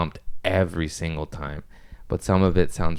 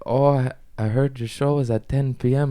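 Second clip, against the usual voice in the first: German accent attempt; 6 semitones higher than usual. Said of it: "I don't know how to do accents, but I'm gonna try".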